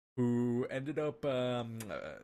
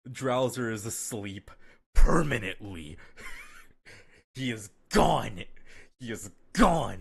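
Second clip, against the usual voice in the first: dramatic declaration; 2 semitones higher, 10 dB louder than usual. Pretend. Dramatic announcement of friend's fate.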